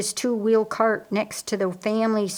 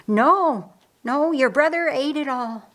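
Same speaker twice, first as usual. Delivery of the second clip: higher, raised complaint; calling back; 6 semitones higher than usual. A narrator's reading. Martha tells Mary about the honey butter, calling back. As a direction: call it back across the yard.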